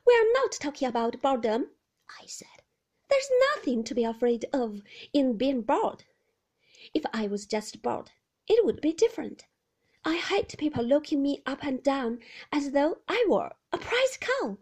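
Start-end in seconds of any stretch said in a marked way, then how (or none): 0.1-1.7 put-on high, pleading young woman's voice, louder; anxious young wife. Young wife pleading with Maxim about visitors, higher and louder. Heroine arguing back. 3.1-14.6 put-on high, pleading young woman's voice, louder; anxious young wife. Young wife pleading with Maxim about visitors, higher and louder. Heroine complaining.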